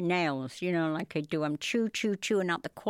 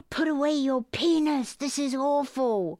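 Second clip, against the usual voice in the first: mock outrage; 6 semitones higher than usual. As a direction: play the scandalized victim after a gasp. Acts out what she'd tell him.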